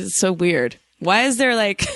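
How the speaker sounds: ordinary talk, nothing marked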